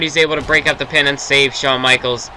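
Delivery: wrestling commentator calling the match; steady ringside play-by-play; play-by-play commentary. Acted. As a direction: call the action like a TV announcer.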